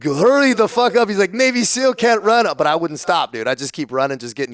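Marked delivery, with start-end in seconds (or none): 0.0-1.1 higher, louder mock drill yell; retold yell. Instructor yelling at recruit during the run, mock yell. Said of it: "yelling at me in French". 1.3-2.5 mock taunt. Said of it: "yelling at me in French".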